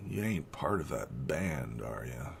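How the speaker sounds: a hint of a suspicious old farmer. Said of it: "He's like".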